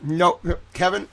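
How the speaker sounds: ordinary talk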